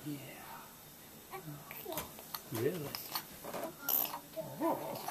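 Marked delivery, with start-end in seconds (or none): none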